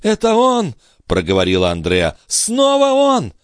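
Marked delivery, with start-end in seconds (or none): 0.0-0.7 very high woman's voice; alarmed cry. Acted. Sister realises the attacker was listening, high alarmed cry. 2.3-3.3 very high, drawn-out cry; alarmed cry. Acted. Sister cries that he is back, high drawn-out alarm. Sister spots the attacker again.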